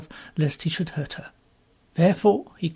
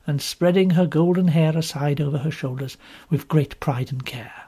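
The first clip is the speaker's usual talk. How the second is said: usual speaking voice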